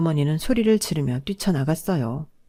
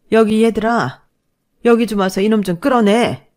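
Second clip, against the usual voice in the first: loud, higher call across the room; raised commanding call; 4 semitones higher, 6 dB louder than usual. Acted. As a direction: summon the boys to drag him out. Mother calls nephews over.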